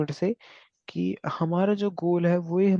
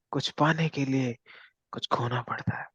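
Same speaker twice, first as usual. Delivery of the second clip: filmy dialogue; 3 semitones lower, 6 dB quieter than usual. Retold or imitated. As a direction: deliver it like a dramatic film punchline. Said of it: "ये फिल्मी dialogue है". (फ़िल्मी अंदाज़ में धीमा फुसफुसाता dialogue)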